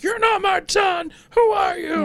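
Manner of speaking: put-on high, loud drunken bellow; drunk dad yelling. Drunk dad yells at zombie son, furious high shout. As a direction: scream it at the kid like a furious drunk father. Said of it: "he starts yelling at Tommy".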